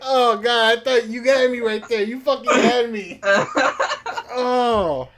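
loud, high, laughing outburst; real cracking up. Real, not acted. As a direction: blurt it out through helpless laughter. Listener cracks up at punchline.

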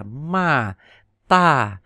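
sharp falling "ma"; Mandarin fourth tone. Shown as a demonstration. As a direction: drop "ma" from the top. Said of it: "từ trên cao đi xuống".

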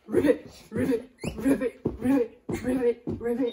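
loud quick ribbits in a row; loud rapid frog croaks; fast ribbit. Shown as a demonstration. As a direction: rattle off the croaks like a busy frog. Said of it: "Now let's go fast".

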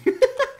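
short high giggle; teasing laugh. Real, not acted. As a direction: laugh it off after kidding. Laughing after saying just kidding.

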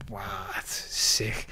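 slow, hushed whisper of disbelief; awed whisper. Retold or imitated. After smashing the whole division, he whispers to himself in awe. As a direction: breathe it out slowly, stunned.

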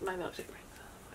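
very soft, quick whisper; barely audible, hurried whisper. Shown as a demonstration. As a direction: whisper the phrase under your breath. Repeating the whisper challenge phrase.

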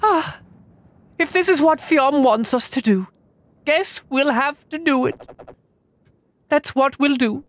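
high character voice. Acted. Voicing game character dialogue.

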